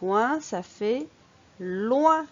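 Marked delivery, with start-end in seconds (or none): 1.6-2.3 "loin" said louder, as one syllable; model for repeating. Shown as a demonstration. Teacher blends L with ouin for the pupil, emphatic. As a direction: sound out "loin" firmly for the learner to copy. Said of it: "À ton tour".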